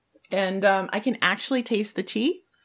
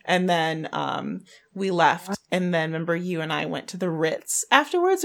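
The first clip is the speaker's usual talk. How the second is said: normal talking voice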